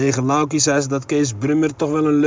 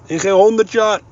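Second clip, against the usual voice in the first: loud, higher, totally sure of himself; a firm exclamation, higher and louder; called out with conviction; 6 semitones higher, 5 dB louder than usual. A narrator's reading. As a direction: fling it out as a flat refusal. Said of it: "riep Kees vol overtuiging".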